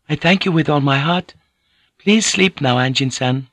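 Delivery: put-on high, gentle, accented woman's voice; humbly grateful, pitched well up; deferential Japanese consort. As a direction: speak as a devoted, courteous consort. Voices Fujiko in the dialogue.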